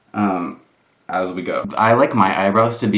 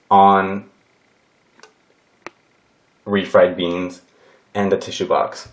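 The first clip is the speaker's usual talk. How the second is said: normal talking voice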